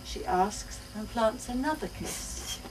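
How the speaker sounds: plain reading voice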